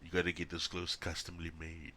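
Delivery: usual speaking voice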